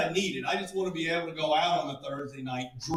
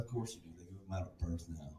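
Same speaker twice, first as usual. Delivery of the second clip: low, very soft grumble; resigned mutter; 10 semitones lower, 15 dB quieter than usual. Acted. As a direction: concede under your breath. Resigned aside to the girl.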